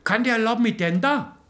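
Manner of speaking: eager fan asking; imitating father. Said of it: "Und dann hat er dann gesagt".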